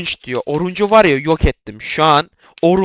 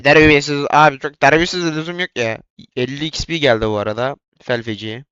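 usual speaking voice

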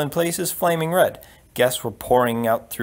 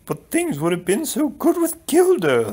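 high-pitched queenly wail; lamenting queen; 11 semitones higher than usual. Acted. Queen to prince and count, regretting the match, high lament. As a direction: plead in a much higher voice.

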